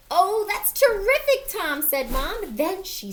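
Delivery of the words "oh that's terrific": pleased mom. Acted. Mom reacts brightly.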